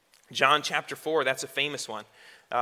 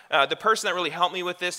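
ordinary talk

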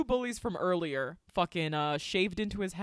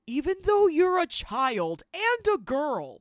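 loud, high, drawn-out mock threat; mock bully threat; 9 semitones higher, 8 dB louder than usual. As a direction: play the bully, hammed-up and incredulous, stretching it out loudly.

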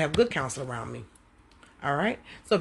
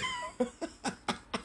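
real laugh; 10 dB quieter than usual. Real, not acted. Preacher laughing at her own joke about running from temptation. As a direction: burst of high laughter. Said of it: "I'm laughing".

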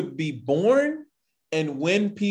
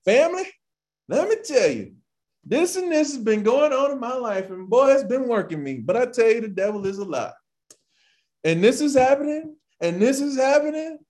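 preacher impression; 8 semitones higher than usual. Mimicking a pastor preaching to the congregation, hammed up. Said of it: "the whole thing was like".

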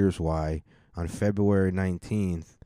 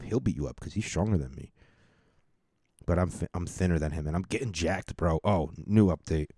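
ordinary talk

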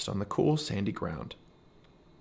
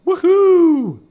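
a high, loud joyful whoop; gleeful whoop; 14 dB louder than usual. Acted. Squirrel leaping into salty water, high loud whoop. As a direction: throw yourself into it with a big cheer. Merle leaps into the Dead Sea.

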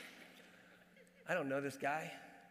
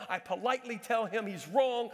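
normal talking voice

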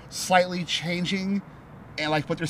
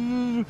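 long, higher-pitched droning hum; imitated humming sound; 7 semitones higher than usual. Storyteller imitating the elephant vision's voice, humming. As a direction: hold it as one steady drone. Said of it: "It's like this humming sound".

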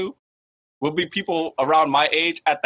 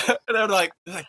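usual speaking voice

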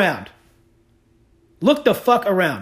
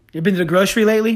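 usual speaking voice

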